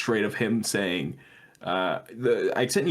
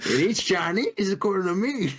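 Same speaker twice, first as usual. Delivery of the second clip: mock senator; 5 semitones higher than usual. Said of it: "he's like".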